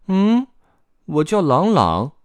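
put-on high young boy voice; little boy. Woken boy answers his visitors, high child voice.

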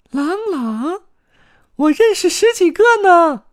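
very high, loud little voice; piercing child-like cry; shouting. A narrator's reading. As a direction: squeal it out excitedly. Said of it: "卡门喊道".

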